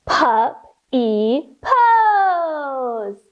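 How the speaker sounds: slow whimpery puppy whisper; teacher bouncing in puppy pose, slow puppy whimper. Pretend. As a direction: drag it out like a whining pup. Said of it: "bounce here for puppy pose".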